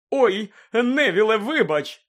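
loud, high apology over laughter; exclaiming. A narrator's reading. Fred apologises to Neville over the laughter, exclaiming. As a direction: call out over a laughing crowd. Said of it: "вигукнув Фред, перекрикуючи сміх".

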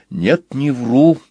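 ordinary delivery

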